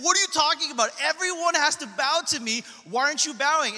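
loud, high indignant demand; shouting in outrage at the man who won't bow; angry Haman. Acted. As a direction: bark it like a furious official. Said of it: "He's like".

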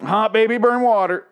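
high, loud garbled blurt; hot baby, burn water blurted high and loud; mock Eugene blurt. Mangled Eugene quote, high loud blurt. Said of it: "No, hot water".

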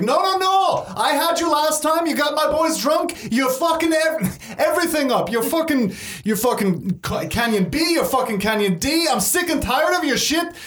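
very high, ranting character voice; irate cave woman. Acted. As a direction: rant like a fed-up sister. Voices Fiona ranting at her brother.

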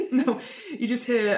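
ordinary talk, nothing marked

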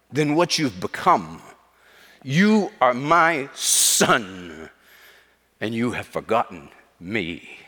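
booming father's rebuke, slowing to solemn weight; Mufasa. Retold or imitated. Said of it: "his dad has that booming voice".

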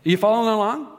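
loud, high call to the room. Real, not acted. Pastor checking the congregation follows, loud call. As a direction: rouse the crowd to answer.

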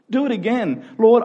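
ordinary talk

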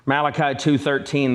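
ordinary delivery

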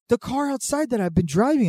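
high-pitched mock exasperation; hammed-up incredulous insistence; exasperated coworker. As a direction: imitate someone desperately explaining the obvious. Retold exasperated coworker insisting on car.

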